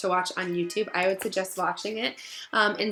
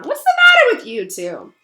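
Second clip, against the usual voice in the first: sneering Malfoy; 10 semitones higher, 10 dB louder than usual. Acted. Malfoy demands answers from Crabbe and Goyle, sneering.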